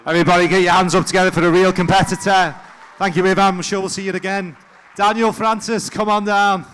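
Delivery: high, projected event host voice; crowd hype. Real, not acted. Host urging crowd to cheer for the winner, rousing hype. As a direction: whip up the audience loudly.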